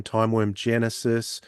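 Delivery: usual speaking voice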